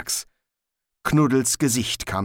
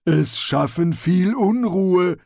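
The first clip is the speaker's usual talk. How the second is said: loud, high, slow troll speech; warning troll; 9 semitones higher, 5 dB louder, slower than usual. Acted. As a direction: warn with ponderous relish as a troll. Troll warns, enjoying it. (warnender Troll, laut und langsam)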